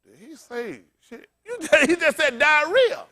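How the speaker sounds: slow, scandalised church lady; mock scandalised. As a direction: drag it out in fake shock.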